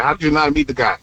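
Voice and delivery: ordinary talk, nothing marked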